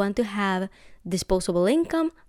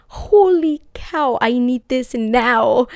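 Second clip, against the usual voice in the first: excited; 4 semitones higher, 8 dB louder, much slower than usual. Retold or imitated. Reaction to the low price.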